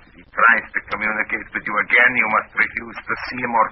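commanding Russian officer voice; Russian accent. Acted. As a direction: give orders like a Soviet superior. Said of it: "in my best Russian accent".

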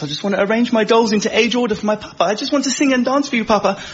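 a mild mock of a sweet young daughter talking to papa; little girl voice. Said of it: "dancing princess".

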